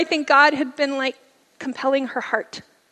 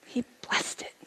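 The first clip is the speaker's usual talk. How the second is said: moved whisper; 13 dB quieter than usual. Real, not acted. Telling the audience of grace, whispered. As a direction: hush it with feeling. Emotional testimony.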